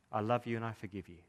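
husband answering every fault softly with forgiveness; tender. Acted. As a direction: read it like a quiet love note. Husband's tender note to wife.

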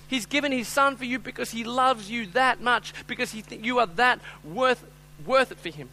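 high, loud impassioned sermon; full-force preaching. Real, not acted. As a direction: preach it out with urgency.

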